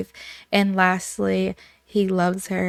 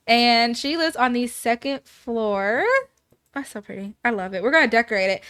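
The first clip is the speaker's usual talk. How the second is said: normal talking voice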